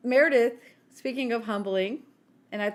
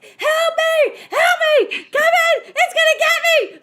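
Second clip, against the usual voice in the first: high, loud panicked scream; screaming for help; 12 dB louder, slower than usual. Retold or imitated. Scared of a lizard, screaming for help. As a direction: shriek for help at the gecko. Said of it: "I'm screaming".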